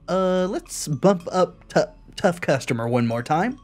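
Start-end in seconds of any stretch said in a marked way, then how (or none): none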